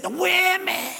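high, stretched-out "women"; leering imitation. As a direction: mimic him savoring the words. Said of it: "exactly the way he said".

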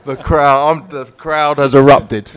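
ordinary talk, nothing marked

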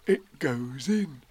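slow, pondering bear voice; Pooh. Acted. Pooh observing the pot, slow wonder. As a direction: draw out each word in wonder.

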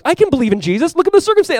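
high, excited mock enthusiasm; gushing easy believer. Believer when circumstances are perfect, gushing excitedly. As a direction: gush it in a high voice.

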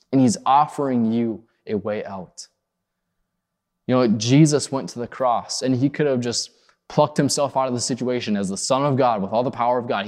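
ordinary delivery

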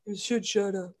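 soft, dim big dog; dopey dog voice. Retold or imitated. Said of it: "dopey voice".

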